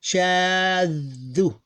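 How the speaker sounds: "shaddu" with long vowel and held middle consonant; sustained, unbroken demo of "shaddu"; blended without stopping. Shown as a demonstration. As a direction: draw out "shaddu" with no gap between syllables. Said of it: "we really blend all of them together".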